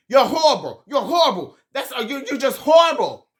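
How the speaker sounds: high, loud imitation of an outraged yell; mock yelling. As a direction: imitate someone yelling an insult across the table. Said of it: "Robin yells at Wendy".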